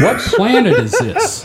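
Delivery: high, laughing disbelief; baffled and half laughing at the picture; confused laughing question; befuddled. Real, not acted. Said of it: "I love the befuddlement".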